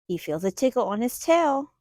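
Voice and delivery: ordinary delivery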